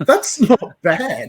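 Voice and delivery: laughing through the words. Real, not acted. Guest laughing at host's Aussie try. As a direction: chuckle out the praise.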